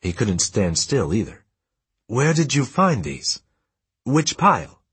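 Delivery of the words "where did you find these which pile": high, drawn-out eager questions; urgent questioning. A narrator's reading. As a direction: press for an answer, pitch raised. Father urgently asks about find.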